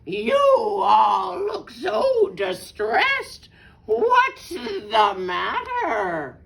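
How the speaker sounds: loud, slow, drawn-out fox voice; big theatrical storybook fox; fox's mock concern. A narrator's reading. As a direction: stretch each word with showy concern. Sly fox feigns concern for birds.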